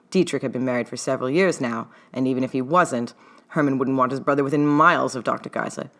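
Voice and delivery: ordinary delivery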